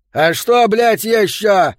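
loud, high, slow exasperated snap; irritated shout. A narrator's reading. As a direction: snap at the newbie. Yo snaps at newcomer.

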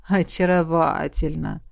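low, slow approval; approving dragon. A narrator's reading. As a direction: savour the word. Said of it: "одобрил дракон". (низкое одобрительное протяжное)

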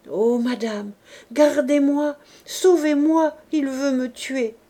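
put-on young wife begging the lady to save her; frightened pleading. A narrator's reading. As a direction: beg for protection as if your life depends on it. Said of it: "Elle implora".

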